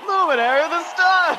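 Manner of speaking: loud, high cheering call; excited shout. Pretend. Friend cheering Kaito Momota's award, loud excited shout. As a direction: shout it out like a proud fan.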